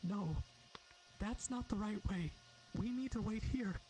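put-on high, quivering child's voice; a scared little girl trembling. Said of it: "She speaks with a quivering voice".

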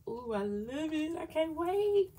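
rising to a high, loud squeal; can't-wait thrill, climbing in pitch; getting excited. Real, not acted. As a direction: gush with building eagerness. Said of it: "I'm just getting excited off these dashboards".

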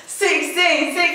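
laughing. Real, not acted. Counting, laughing at challenge.

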